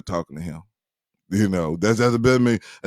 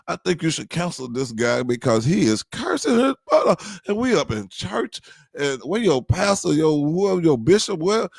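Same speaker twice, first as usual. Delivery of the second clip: higher-pitched, mounting disbelief; indignant complaint; 7 semitones higher than usual. Acted. Churchgoer complaining to deacon friend about cursing guard, indignant complaint. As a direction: protest as if scandalized, climbing higher.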